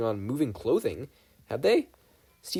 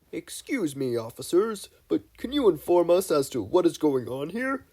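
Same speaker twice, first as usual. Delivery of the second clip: higher, louder, put-on grown-up official; posh official voice; 5 semitones higher, 3 dB louder than usual. A narrator's reading. Mabel posing as congresswoman, louder, higher and put-on. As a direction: Mabel playing a stuffy official.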